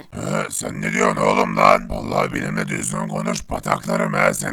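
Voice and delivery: a tough robot with no tune in the voice; gruff toneless robot. Acted. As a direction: speak like a machine, threatening.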